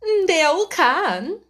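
haughty duchess voice; lofty noblewoman, condescending; imperious plummy grande dame. A narrator's reading. Duchess voice in story.

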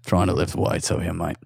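put-on Australian accent. Imitating Hemsworth, Australian.

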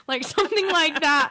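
normal talking voice

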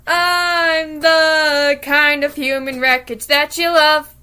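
drawn-out, slow charity-ad read; milk it like a charity appeal; mock charity appeal; slow ad pitch.

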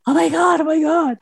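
high-pitched retold amazement; overwhelmed disbelief. Swimmer retells spotting her name after winning, overwhelmed disbelief. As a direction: replay the stunned thrill, pitch leaping right up. Retells seeing she had won.

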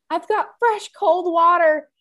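high, loud fussy concern; worried stranger. Retold or imitated. Imitating the anxious woman fussing over the dog. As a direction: squeak out the worry like an anxious dog lover.

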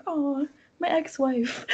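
slow, put-on ex-husband line; imagined ex-husband. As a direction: imitate him slowly reminiscing about an ex. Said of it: "he's just like".

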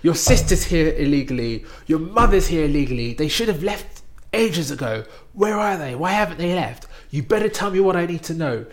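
bully her with threats; interrogator threatening. Retold or imitated. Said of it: "the guy's banging the table".

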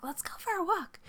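light high "Let's go for a walk"; bright quoted suggestion. Retold or imitated. Recalling her own idea, lifted high and airy. Quoting herself, high far.

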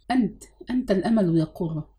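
ordinary delivery

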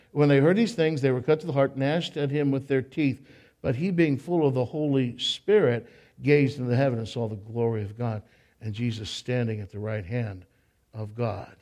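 soft, hushed reading trailing down; quiet sermon reading of the verse; scripture reading. Acted. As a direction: read the verse reverently, fading. Reads scripture passage.

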